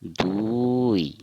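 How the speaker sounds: दुई drawn out very slowly, du-u-i; Assamese pronunciation. Shown as a demonstration. Teacher answering for learners in Assamese, slow stretched du-i. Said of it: "असमिया में क्या कहेंगे".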